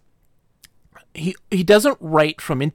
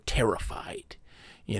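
whispering; 4 semitones lower, 8 dB quieter than usual. Real, not acted. Whispered narration.

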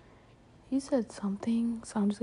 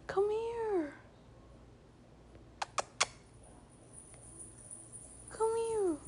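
coaxing call; 12 semitones higher, 10 dB quieter, slower than usual. Real, not acted. Coaxing someone named Cora over.

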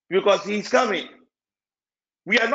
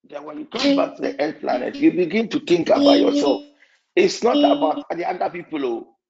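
ordinary talk

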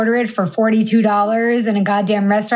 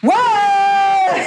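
high, drawn-out whoop; high whoop breaking into laughter; 10 semitones higher than usual. Real, not acted. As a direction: let out a long high "Whoa". Whooping after mock telling-off.

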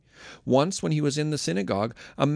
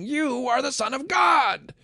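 demons shouting; 13 semitones higher than usual. Acted. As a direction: proclaim it as a possessed shriek.

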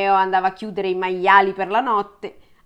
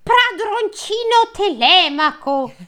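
high, slow, joyful calling out; joyful cry; 12 semitones higher, 4 dB louder, slower than usual. A narrator's reading. Servant joyfully greeting young master, high slow cry. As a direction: call him out with delight. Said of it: "gridò gioiosamente".

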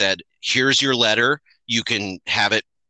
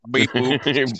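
toy robot voice. Pretend.

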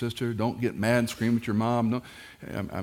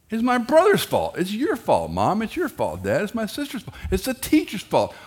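put-on high, loud whiny child voice; a child whining that it's never his fault; whiny kid blaming; 13 semitones higher, 5 dB louder than usual. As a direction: play the kid pointing fingers at everyone.

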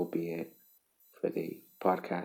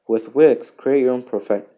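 loud, higher, hammed-up ad read; mock ad announcer; 5 semitones higher, 11 dB louder than usual. Podcaster reading a skipped web ad as a loud announcer. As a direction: sell it like a pushy online ad.

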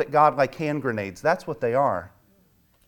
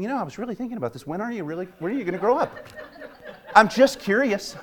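higher, fast, sarcastic faux-sweet; mock innocent curiosity; 5 semitones higher, faster than usual. Wife pretending to merely ask husband, with a laugh. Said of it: "She's not saying".

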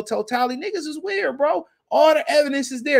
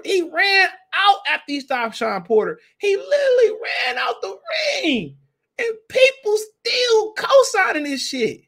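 high-pitched, incredulous rant; exasperated outburst; 10 semitones higher than usual. Real, not acted. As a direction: cry it out in disbelief, voice climbing high. Exasperated rant at fighter's defenders.

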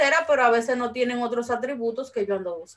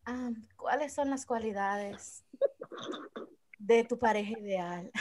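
sensual voice; 3 semitones lower, 8 dB quieter than usual. Pretend. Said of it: "diga el tema de hoy en voz sensual".

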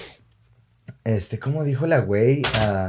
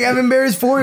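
much higher, cringing whine; embarrassed whine; 11 semitones higher, 3 dB louder, faster than usual. Retold or imitated. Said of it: "I get embarrassed".

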